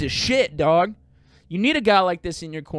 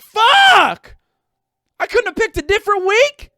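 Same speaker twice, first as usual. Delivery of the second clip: much higher, loud exasperated yell; frustrated shout; 9 dB louder, slower than usual. Retold or imitated. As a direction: blow up in mock despair at the bad timing. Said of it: "And then I'm just like".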